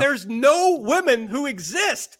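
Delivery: high, drawn-out contrarian parody; mock objection. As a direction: parody an indignant know-it-all, slowly. Playing devil's advocate in mock argument.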